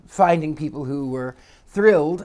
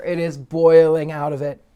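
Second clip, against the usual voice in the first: normal talking voice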